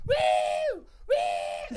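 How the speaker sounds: high whooping woo; laughing hammed-up woo. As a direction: whoop like rowdy teens. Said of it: "they all went".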